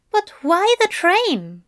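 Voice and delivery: high, pressing angel voice; puzzled angel. A narrator's reading. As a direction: press for answers, pitched well up. Angel's urgent questions.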